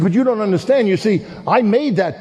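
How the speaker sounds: a hint of a high child's voice; lightly voiced boy claiming his boat; pleading boy. Boy protests.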